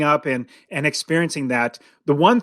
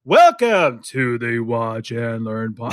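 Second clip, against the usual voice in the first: lower, slow, flat mock of Dad; imitating Dad; 6 semitones lower, much slower than usual. Son imitating Dad's lifeless podcast intro, low and slow. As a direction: drone it slowly like a stiff dad. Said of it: "no, he go".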